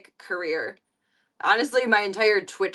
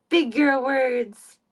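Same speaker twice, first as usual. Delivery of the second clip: slow praise; 4 semitones higher, 5 dB louder, much slower than usual. Shown as a demonstration. Praising cat slowly.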